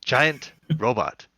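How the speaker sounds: completely straight face, slow; deadpan. Retold or imitated. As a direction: say it dead serious and slow. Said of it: "says it with a complete straight face".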